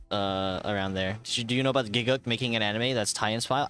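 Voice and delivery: ordinary talk, nothing marked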